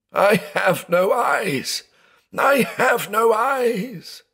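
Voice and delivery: very high, drawn-out anguished wail; sobbing. A narrator's reading. Blind man stumbling and bruised, sobbing. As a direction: wail it slowly, stretching the words in despair.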